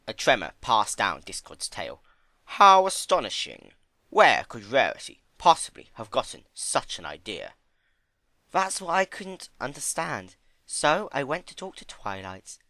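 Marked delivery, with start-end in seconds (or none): 2.4-7.5 overdone innocent shock; mock astonishment. Discord mock surprise. 8.5-12.6 high, gentle pony voice; high timid pony. Acted. Fluttershy voiced.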